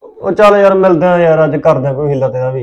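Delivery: loud, offhand brush-off; loud dismissive. Acted. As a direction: shout her down and get off the line. Brushing off girlfriend on phone. (ਉੱਚੀ ਆਵਾਜ਼ ਵਿੱਚ ਟਾਲਣਾ)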